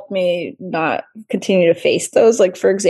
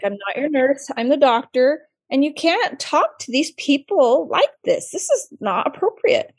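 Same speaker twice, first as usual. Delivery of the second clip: higher, measured retold scolding; firm correction; 6 semitones higher than usual. Intern correcting patient who called her nurse, retold firm rebuke.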